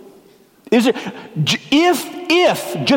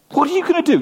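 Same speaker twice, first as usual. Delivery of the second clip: mocking taunt; 11 semitones higher, much faster than usual. Mocking leaders taunt Jesus.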